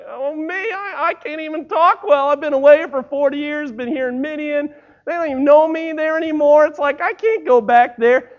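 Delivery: high, loud, protesting Moses; reluctant Moses. Acted. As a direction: plead your way out of the call.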